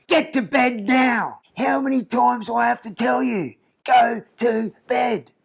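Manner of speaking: put-on high, slow, bossy little girl scolding; toddler playing stern parent. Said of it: "she's like".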